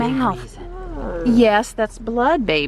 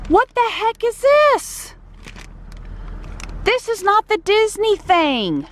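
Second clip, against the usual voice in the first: mock outrage; 14 semitones higher, slower than usual. Real, not acted. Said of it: "I am so mad".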